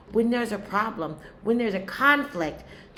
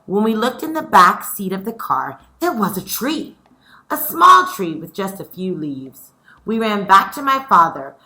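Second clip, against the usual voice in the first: louder, lively storytelling voice; story reading to children; 11 dB louder than usual. A narrator's reading. Teacher reading chapter four to her scholars, lively. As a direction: read it out like a picture book for kids. Reads the story aloud to class.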